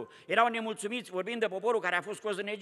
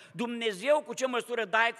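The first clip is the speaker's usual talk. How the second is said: usual speaking voice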